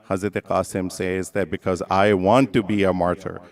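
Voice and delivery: normal talking voice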